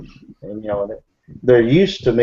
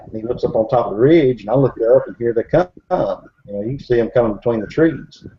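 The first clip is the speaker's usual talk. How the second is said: ordinary delivery, nothing marked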